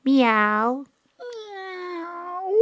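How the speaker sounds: high, mewing cat sound rising into a plaintive cry; a pitiful cat crying; cat meow. Acted. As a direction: mew like a lonely cat. Actor voicing cat in drama.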